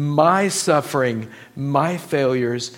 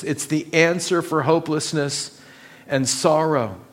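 ordinary talk, nothing marked

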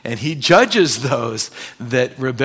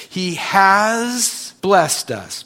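slow, stretched "He has blessed us"; stress past tense; 5 semitones higher, much slower than usual. Shown as a demonstration. Preacher stressing to the church that it is done, slow. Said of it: "past tense".

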